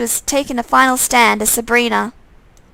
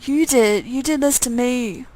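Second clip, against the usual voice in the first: menacing undead twin. Acted. Twin accuses in monster voice.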